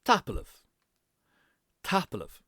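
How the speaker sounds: Tapalev run together with live squeezed short; Tapalev, live swallowed; contracted tapalev. Shown as a demonstration. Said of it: "kind of contracted as well".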